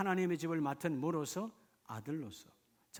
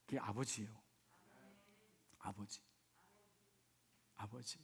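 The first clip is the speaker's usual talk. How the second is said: hushed; 5 semitones lower, 11 dB quieter than usual. Acted. Soft after loud preaching.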